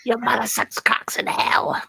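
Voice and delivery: whispered demon line; possessed whisper. Acted. For friends, whispered demon line. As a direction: hiss it like the Exorcist.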